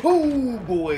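high loud scared yelp; startled shriek. Real, not acted. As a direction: shriek in surprise. Monster appears.

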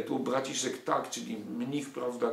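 ordinary talk, nothing marked

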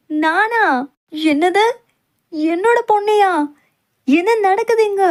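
shocked outcry. Acted. Father reacting to his daughter's proposal, shocked and loud. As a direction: burst out in disbelief.

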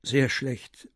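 usual speaking voice

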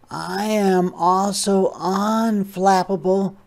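high, slow, drawn-out sloth voice; unhurried sloth. Acted. Sloth voiced in read story.

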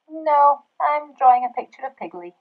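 loud, high protest easing slightly; insistent refusal. Acted. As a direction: protest like a busy child. Busy pig refuses bedtime.

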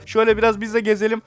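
usual speaking voice

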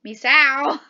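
loud call of a name. Acted. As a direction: call out for someone missing, loudly. Calls out for missing Misao.

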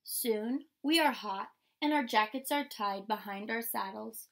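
usual speaking voice

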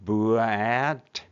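ブアット slowly drawn out, sounds sliding together; ブアット as a slow phonics blend; stretched blending. Shown as a demonstration. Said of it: "ブレンディングすると".

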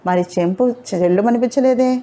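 plain reading voice

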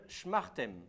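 "schmartem" with no vowel after the sh; correct shmartem. Shown as a demonstration. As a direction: say "schmartem" with sh running straight into m. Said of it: "Non pas shamartem, mais shmartem". (« schmartem » sans voyelle entre ch et m)

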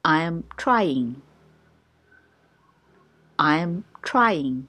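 very slow, each syllable clear; slow teaching pace; slow drill. Shown as a demonstration. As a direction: spell it out slowly for learners. Slow drill repeated twice.

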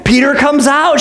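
ordinary talk

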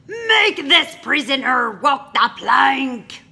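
loud, high shouted pirate order; captain shouting a command to his crew. Acted. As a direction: roar the order across the deck.

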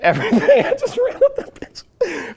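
loud, high, laughing through the words. Real, not acted. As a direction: crack up while finishing the story. Laughing at his own escape.

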